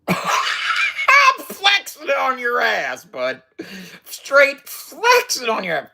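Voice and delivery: high, slow, laughing brag shouted at the camera; excited bragging shout. Real, not acted. Flexing on camera, he shouts his brag to viewers, high and laughing. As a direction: crow about it through laughter.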